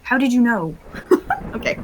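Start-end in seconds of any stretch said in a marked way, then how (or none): 0.8-1.5 breathless giggle. Real, not acted. Laughing at players.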